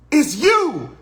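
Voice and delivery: high, loud mock amazement; meeting a sitcom actor, amazed; retold starstruck surprise. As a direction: recognize your idol, starstruck. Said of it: "I was like".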